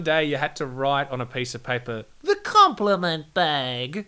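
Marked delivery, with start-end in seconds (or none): 2.2-4.1 drawn out. Acted. Names the bag, drawn out.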